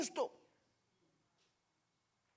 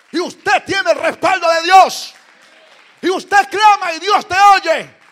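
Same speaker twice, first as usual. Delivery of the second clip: loud, building to a shout; rising preaching shout; 5 semitones higher, 22 dB louder than usual. Acted. Preacher proclaiming God's backing, loud and building. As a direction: proclaim the church's power.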